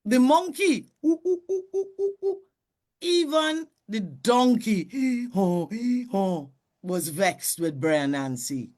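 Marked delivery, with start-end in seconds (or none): 1.1-2.4 high monkey hoots. Acted. Monkey sound. 4.9-6.5 donkey bray. Acted. Donkey sound.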